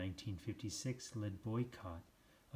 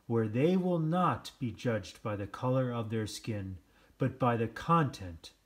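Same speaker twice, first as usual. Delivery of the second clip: speech oratory; 4 semitones higher, 10 dB louder than usual. Acted. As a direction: proclaim the dream to the crowd. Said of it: "he said in his speech".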